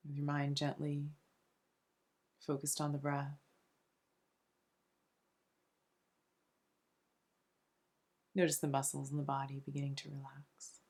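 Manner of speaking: soft, soothing meditation voice with long pauses between phrases; unhurried, hushed relaxation guide; guided meditation. Acted. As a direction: gently guide them into calm, phrase by phrase.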